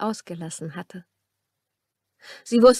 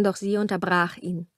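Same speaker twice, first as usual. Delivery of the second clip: normal talking voice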